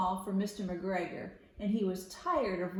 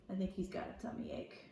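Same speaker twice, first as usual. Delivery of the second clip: whispered aside; 2 semitones lower, 9 dB quieter, faster than usual. Real, not acted. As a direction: lean in and whisper it fast. Aside to listening children.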